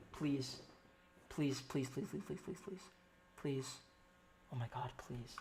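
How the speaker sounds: soft, repeated pleading under the breath; desperate begging. Real, not acted. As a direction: beg quietly, almost giving up.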